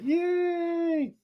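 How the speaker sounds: squeaky high mock "Yay!", mocking a cheap celebration; mock tiny cheer. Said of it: "cheap ones where it's like".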